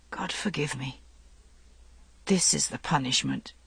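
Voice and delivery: very soft, low near-whisper. A narrator's reading. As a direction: confess in a hushed voice. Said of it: "He whispered at last".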